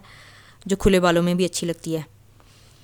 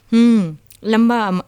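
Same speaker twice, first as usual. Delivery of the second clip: slow, louder pompous holy man hum; fake holy man; 3 semitones higher, 6 dB louder, much slower than usual. Fake baba to Maya, slow and louder pompous. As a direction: drag it out like a fraudulent baba.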